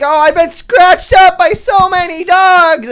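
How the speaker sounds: high, loud drawn-out wail; hammed-up, slow screamed complaint; mock screaming. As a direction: imitate her wailing in outrage. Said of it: "Yeah, she's screaming".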